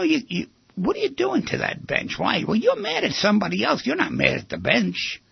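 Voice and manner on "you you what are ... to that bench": high, exasperated telling-off; mock scolding. Speaker scolding a teen wrecking a bench, high and exasperated.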